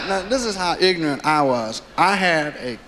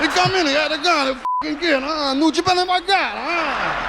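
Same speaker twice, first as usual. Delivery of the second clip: put-on much higher excited mobster accent; Mafia accent; 10 semitones higher than usual. Mobster retells the kid's stickup, excited Mafia accent. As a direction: gangster marveling at the kid, mock amazed. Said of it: "his accent is killing me".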